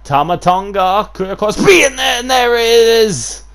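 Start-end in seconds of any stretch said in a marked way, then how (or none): 1.3-3.4 screaming excitement. Real, not acted. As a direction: scream it like the match is won. Climactic moment.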